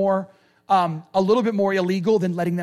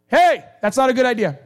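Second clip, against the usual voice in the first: Paul's yell; 2 semitones higher, 6 dB louder than usual. Retold or imitated. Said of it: "Paul yells from inside the prison".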